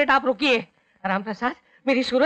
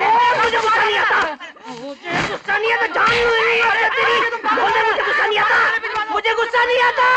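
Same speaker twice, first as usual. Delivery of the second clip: manic angry shout; 7 semitones higher, faster than usual. Acted. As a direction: shout and cackle like a madman. Man shouting, half laughing madly. (पागलों जैसी हँसी के साथ गुस्से से चीखना)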